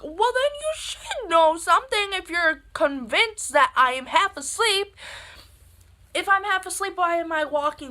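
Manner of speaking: high, loud mock rant; indignant argument. Daughter arguing she was not half asleep, loud indignant rant.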